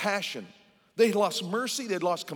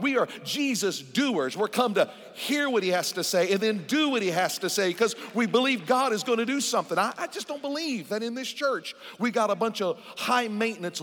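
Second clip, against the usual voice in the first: full-force preaching; 4 semitones higher than usual. Real, not acted.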